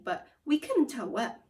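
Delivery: usual speaking voice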